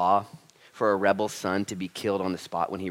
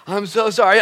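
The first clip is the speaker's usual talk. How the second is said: hammed-up apology; 10 semitones higher, 11 dB louder than usual. Mimicking his own repentance speeches.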